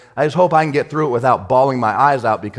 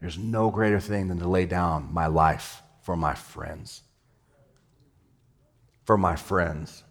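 softer and lower, tender; hushed; 4 semitones lower, 7 dB quieter, slower than usual. Acted. Pastor voicing Jesus to the church, hushed and tender. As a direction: say it like a quiet confession. Jesus is just sitting there saying.

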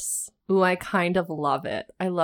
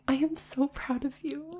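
soft, high-pitched proud coo; mock-tender praise, pitched high; 8 semitones higher, 6 dB quieter than usual. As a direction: gush softly, imitating a proud onlooker. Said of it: "she has just this face like".

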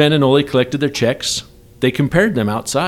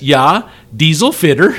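mock Scandinavian lilt, "diesel" rising; sing-song Scandinavian "Yeah, diesel fitter" with a laugh; Scandinavian accent; 3 semitones higher, 4 dB louder than usual. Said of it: "with the accent, you pulled it off".

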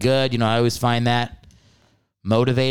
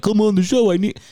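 mock pleading husband; 9 semitones higher, 4 dB louder than usual. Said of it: "She made it sound like".

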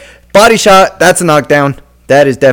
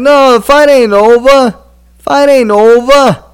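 high, drawn-out mock announcement; stretched, raised voice carrying over the ring; insistent official; 9 semitones higher, slower than usual. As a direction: imitate a referee calling out slowly. Mimics official insisting fight continues.

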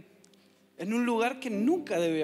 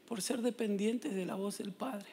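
sermon appeal; 5 dB quieter than usual. Acted. Preaching message to congregation.

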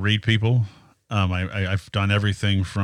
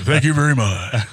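higher, louder, put-on smooth voice; mock suave reply; 5 semitones higher, 4 dB louder than usual. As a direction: accept the praise with a showy croon. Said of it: "I'm like".

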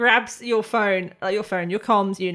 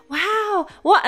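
lightly voiced excited kid; 11 semitones higher, 5 dB louder than usual.

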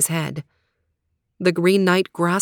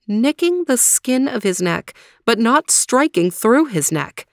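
ordinary delivery, nothing marked

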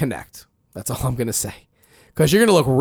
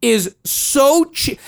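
ordinary talk, nothing marked

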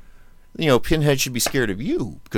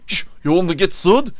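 hammed-up Scottish accent, higher and louder; bad Scottish accent; 8 semitones higher, 6 dB louder than usual. Host doing a movie line to cohost in a bad Scottish accent. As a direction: butcher a Scottish accent on purpose. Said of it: "really bad Scottish accent".

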